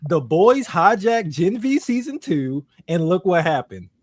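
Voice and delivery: high, loud, fired-up complaining; worked-up rant. Real, not acted. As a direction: vent it like you were proven right. Heated rant about cancelled show.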